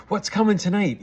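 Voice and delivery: put-on high, loud burst of kids' anticipation; imitating excited kids. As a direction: mimic children eagerly awaiting a treat. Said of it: "The kids were all excited".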